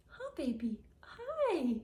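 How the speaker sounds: high squeaky pet voice, cooing. Real, not acted. Cooing at the cat.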